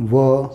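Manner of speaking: "व" as vuh, not vee; phonic sound of V. Shown as a demonstration. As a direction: sound out "व" low, with a neutral vowel. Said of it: "व्हीचा उच्चार आहे".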